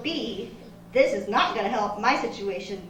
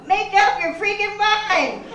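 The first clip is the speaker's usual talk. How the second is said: exasperated; 8 semitones higher, slower than usual. Acted. Scolding a juice flavor.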